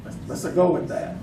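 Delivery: ordinary talk, nothing marked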